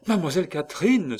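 loud, high, quick exclamation; sudden loud call; excited cry. A narrator's reading. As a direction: cry out the name, fast and high. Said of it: "s'écria Pitou".

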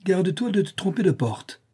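high-pitched warning; menacing. A narrator's reading. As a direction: threaten him pointedly. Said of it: "d'une voix menaçante".

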